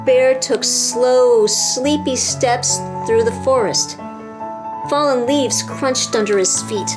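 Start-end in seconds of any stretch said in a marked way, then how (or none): none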